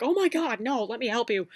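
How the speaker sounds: a hint of panicky fussing; flustered boyfriend. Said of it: "running around like".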